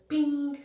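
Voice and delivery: high, loud happy bing; excited high bing. Retold or imitated. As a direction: pop it out bright and sudden. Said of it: "It just made me go".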